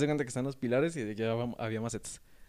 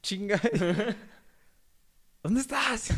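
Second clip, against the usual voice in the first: much higher mother's voice, cracking with laughter; laughing mom impression; 9 semitones higher, 3 dB louder, slower than usual. Mother searching for her son who left, high voice broken by laughs. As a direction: play the angry mom but laugh through it.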